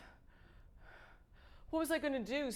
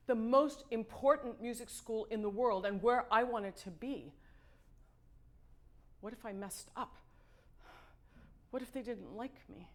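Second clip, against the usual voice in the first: plain reading voice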